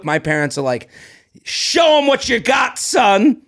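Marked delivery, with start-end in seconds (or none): 1.4-3.4 high cheering dad shout; encouraging shout. Retold or imitated. Parents cheering.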